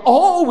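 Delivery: "Oh" drawn out high and loud as an urge; pressing, yearning exclamation; urgent cry. Shown as a demonstration. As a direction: make it a call, not a complaint. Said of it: "not as a dead complaint, but as an urge".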